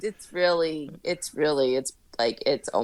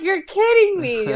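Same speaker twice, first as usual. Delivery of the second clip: mock disbelief; 10 semitones higher, 3 dB louder than usual. Retold reaction to husband.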